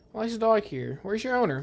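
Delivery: normal talking voice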